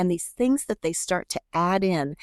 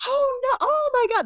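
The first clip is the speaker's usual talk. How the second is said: high-pitched mock excitement, a bit louder; excited parents; 3 dB louder than usual. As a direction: squeal it like thrilled parents hearing big news. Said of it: "The parents are so excited".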